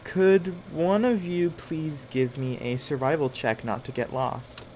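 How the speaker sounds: ordinary delivery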